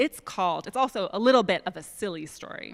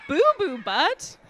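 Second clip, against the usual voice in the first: laughing disbelief; 6 semitones higher, 4 dB louder, slower than usual. Pretend. Reader laughs at silly name.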